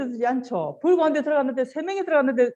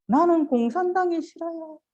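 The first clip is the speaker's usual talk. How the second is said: 이승복; 7 semitones higher than usual. Acted. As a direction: recite it high like a brave boy. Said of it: "이승복?". (높은 어린 소년 목소리로)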